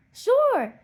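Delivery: high, loud happy "sure"; children agreeing to play; eager group reply. Acted. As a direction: answer all at once, keen. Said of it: "they all reply".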